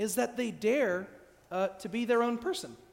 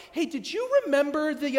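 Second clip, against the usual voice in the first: high, louder casual interruption; interrupting partner; 7 semitones higher, 8 dB louder than usual. Acted. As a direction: break in with a careless question. Acted partner interrupting song.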